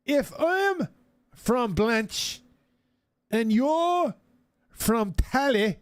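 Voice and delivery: high, slow, over-careful English; slow tourist English. Retold or imitated. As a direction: drag each word out for a foreigner. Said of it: "slowing down their English tune".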